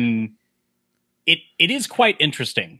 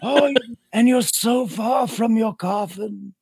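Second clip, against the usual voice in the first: high, slow mock vampire whisper; Radu; 8 semitones higher, slower than usual. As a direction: imitate a soft-spoken vampire, high and drawn out. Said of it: "it doesn't matter how soft he speaks".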